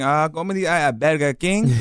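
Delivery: slow, Spanish-accented "Burger King"; put-on Spanish accent, rolling into "Burger King". Shown as a demonstration. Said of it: "when I speak to people in Spanish".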